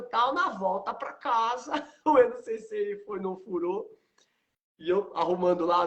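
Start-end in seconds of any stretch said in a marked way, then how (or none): none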